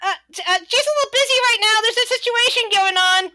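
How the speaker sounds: very high, loud, hurried squeak; flustered kobold. Acted. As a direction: fob off a visitor in a panicky high voice. Flustered kobold brushes off the visitor.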